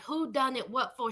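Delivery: half-asleep grumble; groggy boyfriend. Retold or imitated. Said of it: "He opens up the door looking like".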